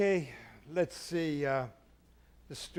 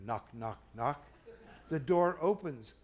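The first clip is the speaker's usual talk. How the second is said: ordinary talk, nothing marked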